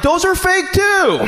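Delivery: high, slow mock-panicked cry; high yelp. Robbed man admits the watch is fake, high yelp. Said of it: "I'm like".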